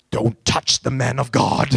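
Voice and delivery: low, laughing, self-important "Don't touch the man of God"; mock-grand pastor voice; pompous preacher mock. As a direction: ham up your own holy pride. Mocking his own self-importance.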